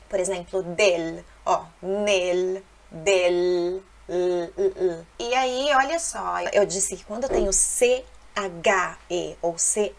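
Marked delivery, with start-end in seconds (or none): 0.6-5.1 del and nel with a full tongue L; clear final L. Shown as a demonstration. Teacher drilling final L sounds. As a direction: land each final l on the teeth. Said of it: "Ou no final de uma palavra".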